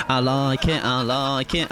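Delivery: normal talking voice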